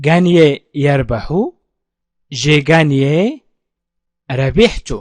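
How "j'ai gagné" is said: very slow, each syllable laid out for learners; slow model phrase. Shown as a demonstration. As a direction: spell it out slowly, teacher modelling. Teacher models French slowly. (نطق بطيء جدًا للتعليم)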